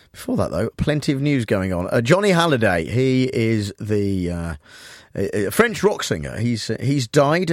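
ordinary talk, nothing marked

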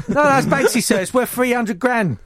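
high-pitched mock pupil protest; pleading schoolboy. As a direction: insist to the teacher like a caught schoolkid. Acted pupil pleading with teacher.

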